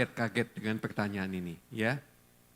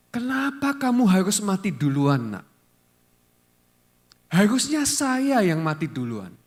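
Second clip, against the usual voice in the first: higher, louder anguished cry of a grieving mother; retold hysterical mother's wail; 4 semitones higher, 4 dB louder than usual. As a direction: cry out the question like a mother who lost her child. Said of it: "mamahnya teriak-teriak histeris dan ngomong begini". (meratap histeris seperti ibu yang kehilangan anak)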